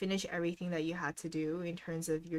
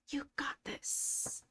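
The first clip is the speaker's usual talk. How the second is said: slow breathy whisper of encouragement; encouraging whisper; 6 semitones higher, 6 dB quieter, much slower than usual. Acted. Streamer cheering on a tired viewer, in a slow whisper. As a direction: whisper it slowly like a secret cheer.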